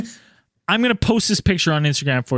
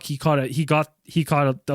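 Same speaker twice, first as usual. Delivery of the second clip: ordinary delivery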